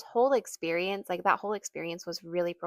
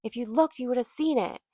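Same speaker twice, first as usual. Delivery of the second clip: imitated yell, higher and louder; mock yelling; 6 semitones higher, 6 dB louder than usual. Woman scolding a mother about the sign, mock yelling. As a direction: hurl it back as her raised-voice rebuke. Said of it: "she yells at me".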